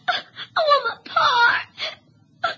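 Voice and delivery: loud, crying plea for his father; tearful plea. Acted. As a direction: sob out the plea. Choking boy calls for father.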